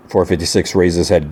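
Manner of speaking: plain reading voice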